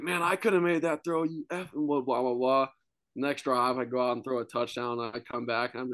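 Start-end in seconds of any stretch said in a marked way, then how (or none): 0.0-2.7 trash-talking fans. Retold or imitated. Fans trash-talking the quarterback, higher taunting jeer. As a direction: taunt him like a drunk fan. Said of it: "talking crap the whole way".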